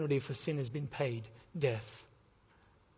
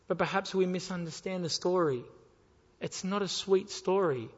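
ordinary talk, nothing marked

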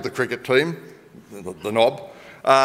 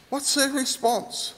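softer, higher repeat; 9 semitones higher, 6 dB quieter, slower than usual. Shown as a demonstration. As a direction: ask it again, higher and hushed.

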